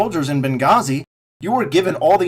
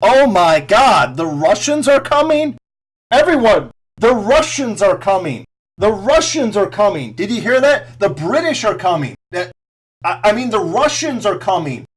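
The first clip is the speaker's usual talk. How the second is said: higher, louder fake panic; mock hysteria; 5 semitones higher, 3 dB louder than usual. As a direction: ham up a frightened alarm cry. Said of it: "Hysteria, freak out".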